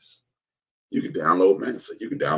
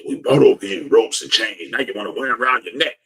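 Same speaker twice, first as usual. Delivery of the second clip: loud, raised mock outrage; indignant reproach; 5 semitones higher, 7 dB louder than usual. Chiding rappers over rope chains, indignant and loud. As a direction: protest it, louder and higher.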